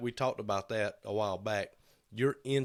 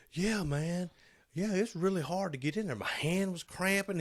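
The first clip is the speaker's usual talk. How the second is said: higher-pitched imitation of a coworker; imitating coworker; 9 semitones higher than usual. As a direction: quote him in a raised, put-on voice. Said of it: "And he's like".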